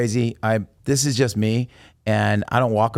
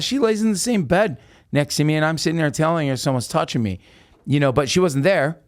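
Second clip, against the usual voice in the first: ordinary talk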